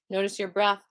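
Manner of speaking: slow, calm breathing cues; guided meditation cue. Acted. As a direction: guide their attention gently.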